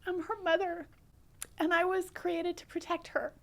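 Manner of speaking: high, halting, tearful voice; choked up. Real, not acted. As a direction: grieve aloud, pausing to hold back tears. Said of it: "I get choked up".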